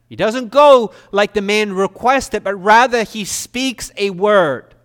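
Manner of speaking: loud, high, emphatic sermon delivery; impassioned preaching. Real, not acted. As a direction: preach it forcefully to the congregation. Preaches at full force.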